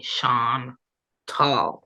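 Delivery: Sean Tall said slowly as two words, shahn then TAHL; teaching listeners her name, slowly: Sean Tall; slow name demo. Shown as a demonstration. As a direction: sound it out, Sean Tall, leaning on Tall. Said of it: "Use that mouth and say".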